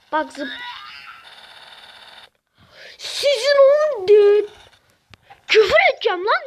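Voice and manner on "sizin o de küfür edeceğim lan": frustrated outburst. Real, not acted. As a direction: wail in rage at the players. Furious at dying. (oyunculara yüksek sesle sinirli sızlanma)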